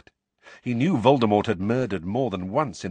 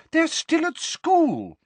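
shrill protest; 6 dB louder, slower than usual. Acted. Mrs Weasley protesting.

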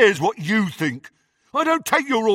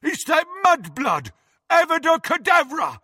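high, loud cry; furious shout; 5 dB louder than usual. A narrator's reading. Henchman spotting his attacker, furious shout. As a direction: scream out the curse.

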